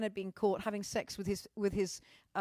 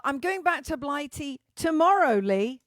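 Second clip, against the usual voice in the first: theatrical announcement; 4 semitones higher, 8 dB louder than usual. Acted. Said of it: "The acting".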